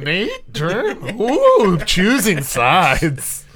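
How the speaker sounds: high, laughing tease ending drawn out; mock-shocked ooh, laughing through it; teasing reaction. Game master reacting to who is hated most, high teasing voice. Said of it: "I was like".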